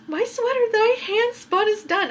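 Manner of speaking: high-pitched proud excitement; excited announcement. Real, not acted. Knitter tells viewers her handspun sweater is done, excited.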